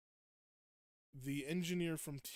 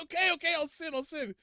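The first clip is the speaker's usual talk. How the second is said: very high, frantic giving in; hammed-up pleading; mock panicked surrender; 13 semitones higher, 8 dB louder than usual. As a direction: yelp it like a cartoon under fire. Shadow gives in as explosions start.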